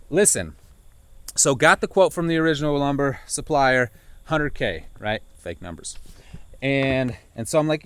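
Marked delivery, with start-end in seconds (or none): none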